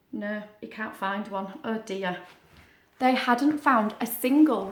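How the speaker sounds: plain reading voice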